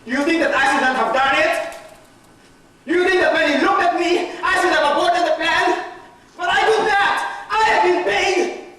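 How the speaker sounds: high, impassioned defence; agitated self-justification. Acted. Judas defends himself to his accusers, agitated and impassioned. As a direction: plead your case with rising agitation, pitched high.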